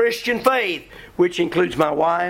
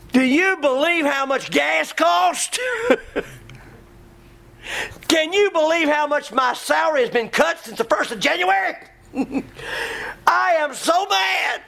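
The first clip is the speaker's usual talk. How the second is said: mock angry rant; 6 semitones higher than usual. Preacher playing a churchgoer fuming over money, mock shouting rant. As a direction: rant about gas prices like a fed-up member.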